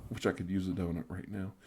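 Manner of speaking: ordinary talk, nothing marked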